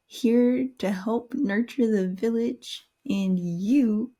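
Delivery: normal talking voice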